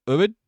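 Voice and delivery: "Ubud" tried as OO-bud, questioning; trying a pronunciation. Shown as a demonstration. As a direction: say "Ubud" as OO-bud, unsure, rising at the end. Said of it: "How do you say Ubud?".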